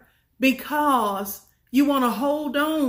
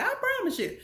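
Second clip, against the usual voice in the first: ordinary talk, nothing marked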